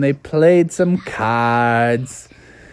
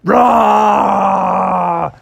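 friendly bear roar; 3 semitones higher, 4 dB louder than usual. Acted. Bubby greets in bear language.

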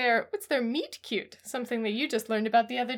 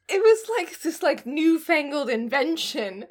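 put-on higher, louder bewildered old-timer; mock old-timer; 5 semitones higher, 5 dB louder than usual. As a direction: act baffled by new tech. Mimicking old-fashioned staff.

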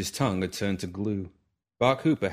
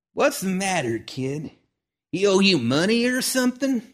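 put-on old man's voice, raised higher and louder, amused; gruff old man teasing; 10 semitones higher, 7 dB louder than usual. Old gunfighter teasing the nervous kid, in a raised, mocking voice. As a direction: needle the kid like a sly old-timer.

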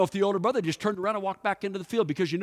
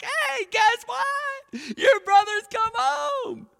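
much higher, loud, drawn-out excited shout; excited servant; 6 dB louder, much slower than usual. Retold or imitated. Servant calls the news to the older brother, excited high shout. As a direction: burst out with the big news, stretching every word. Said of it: "you're like".

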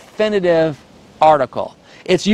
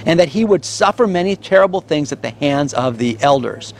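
ordinary talk, nothing marked

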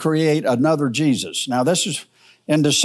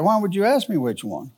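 ordinary talk

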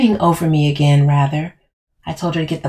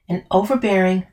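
plain reading voice

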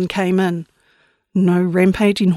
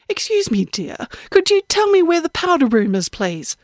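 put-on high, prim little-old-lady voice; a sweet, falsely polite granny; 8 semitones higher than usual. Old woman asking girl for the powder room, prim and high.

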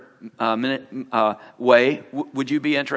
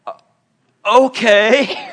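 hesitant okay; 9 semitones higher, 8 dB louder, much slower than usual. Retold or imitated. Reluctant agreement with the Lord.

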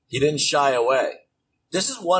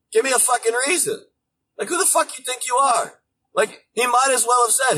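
ordinary talk, nothing marked

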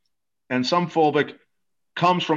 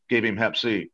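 normal talking voice

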